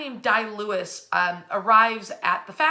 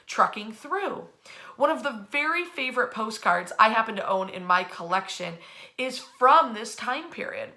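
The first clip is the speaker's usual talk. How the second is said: ordinary talk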